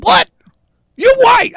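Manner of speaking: high, loud disbelieving shout; acted incredulous retort. Hecklers answering the man's denial, incredulous shout.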